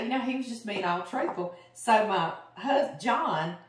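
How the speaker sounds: ordinary delivery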